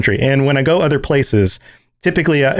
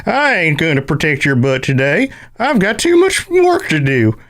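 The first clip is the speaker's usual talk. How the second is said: hammed-up cranky prospector voice; 3 semitones higher, slower than usual. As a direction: grumble like a cartoon gold prospector. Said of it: "I want the cranky prospector".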